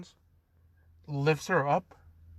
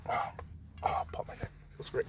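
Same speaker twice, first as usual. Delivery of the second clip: soft unvoiced aside; whispering; 12 dB quieter than usual. Real, not acted. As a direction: whisper it to yourself. Aside while stretching neck.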